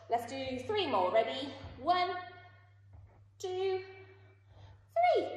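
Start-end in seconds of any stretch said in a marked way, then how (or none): 1.8-5.3 counting up, rising higher and higher. Real, not acted. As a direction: build excitement on each count. Counting kids through exercise.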